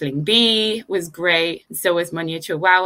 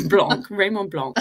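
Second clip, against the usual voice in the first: nasal French Blanc, said as /blɔ̃ŋ/ with a French vowel; mock French accent; 2 semitones higher than usual. Co-host trying Raymond Blanc the French way, playful French accent. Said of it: "in a more French accent".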